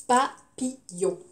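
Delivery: "Papillon" cut into pa, pi, yon; syllable by syllable. Shown as a demonstration. Teacher splits a word for pupils, syllable by syllable. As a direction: slowly separate pa - pi - yon.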